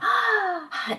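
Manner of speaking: imitating a singer's heavy shoulder sigh, falling; a big falling exhale like a singer gasping mid-song; mock singer's sigh. Mocking singers who breathe with their shoulders, a heavy falling sigh. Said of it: "is when I watch a singer go".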